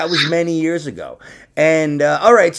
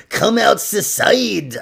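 higher, drawn-out bad German accent; attempted German accent; 5 semitones higher than usual. Shown as a demonstration. Demonstrating a German he can't do, botched German accent. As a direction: stretch it out in a failed German accent. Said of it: "I was trying to do a German".

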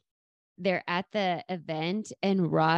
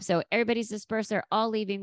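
ordinary talk, nothing marked

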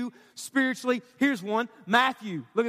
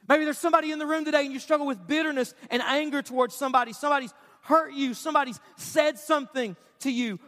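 ordinary talk

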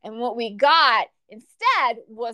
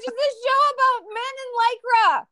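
much higher, fast, exasperated disbelief; incredulous mock; 12 semitones higher, 3 dB louder than usual. Reading a long wrestling-show review, high fast disbelief. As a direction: squeal it out like you can't believe the length. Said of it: "I was like".

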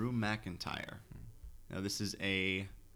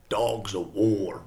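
Strowman impression; 7 dB louder, much slower than usual. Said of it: "my Strowman impression is getting worse".

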